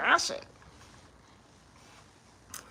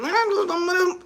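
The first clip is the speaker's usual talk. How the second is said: higher, disbelieving echo; incredulous repeat; 5 semitones higher, faster than usual. Retold or imitated. Comedian echoing the money claim in disbelief, higher voice. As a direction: repeat it as if you can't believe it. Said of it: "somebody said".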